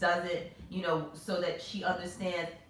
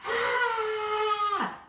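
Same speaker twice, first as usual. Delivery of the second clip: high loud shriek of disgust; fearful scream; 4 dB louder than usual. Retold or imitated. Mother squashing the bug in panic, screaming. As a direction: scream as if crying. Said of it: "screaming inside, like, crying".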